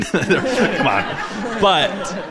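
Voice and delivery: laughing through the words at Logan. Real, not acted. Laughing while joking to the interviewer about Utah. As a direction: chuckle it out, amused.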